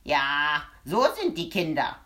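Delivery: little man exclaiming in annoyance; exclaimed. A narrator's reading. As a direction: throw it out in disgust. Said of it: "rief er aus".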